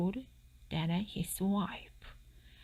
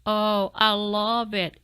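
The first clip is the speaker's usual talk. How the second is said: louder, delighted fox exclaiming; 3 semitones higher, 8 dB louder than usual. Acted. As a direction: gush over the new tooth. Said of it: "exclaimed the fox".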